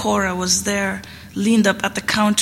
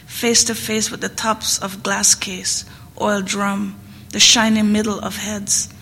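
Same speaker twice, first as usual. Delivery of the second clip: plain reading voice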